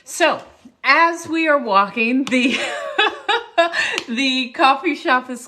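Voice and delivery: loud laughter breaking into the words; laughing. Real, not acted. Storyteller returns to the closed coffee shop, laughing. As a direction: struggle to talk through real laughter. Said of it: "It's all laughter".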